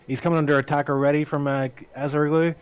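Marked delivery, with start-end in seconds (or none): none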